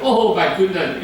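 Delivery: usual speaking voice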